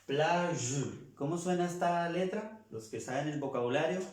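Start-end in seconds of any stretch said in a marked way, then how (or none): 0.0-1.1 "Plage" ending in a buzzed zh sound; "Plage" drawn out on the final zh, no full E; French soft G. Shown as a demonstration. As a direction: model "Plage" with a soft French G, falling at the end. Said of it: "No estoy pronunciando la G con la E".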